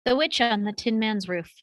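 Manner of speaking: ordinary delivery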